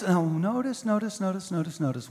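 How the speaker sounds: soft, sinking repetition; hushed emphasis. Acted. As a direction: draw them in quietly. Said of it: "repeated notice, fading".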